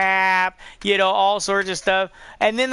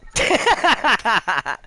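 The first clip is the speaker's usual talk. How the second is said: high, loud laugh tumbling down in pitch; real burst of cackling laughter; hearty laugh; 5 semitones higher, 4 dB louder than usual. Real, not acted. Laughing at his friend's joke, hearty and high.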